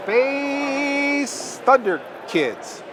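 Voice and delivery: high, drawn-out movie title; dramatic announcer. Acted. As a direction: stretch it out like a trailer announcer. Hyping tonight's feature title.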